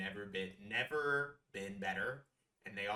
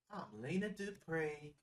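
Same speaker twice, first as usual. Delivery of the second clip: slow, higher-pitched Southern character voice; Lena Dupree; 6 semitones higher, 3 dB quieter, slower than usual. Retold or imitated. Introducing herself as the island hostess, slow drawled impression. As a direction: draw each word out, imitating her drawl. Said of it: "That's how she talks".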